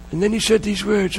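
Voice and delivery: ordinary talk, nothing marked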